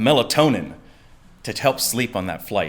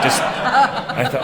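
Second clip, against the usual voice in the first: slow, high laugh into the words; 12 semitones higher, much slower than usual. Real, not acted. Teller laughing about getting instantly stoned, slow and high. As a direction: laugh it out, drawn out.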